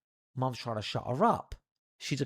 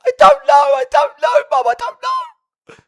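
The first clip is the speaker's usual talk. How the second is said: desperate shout; 17 dB louder than usual. Retold or imitated. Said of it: "So I'm like".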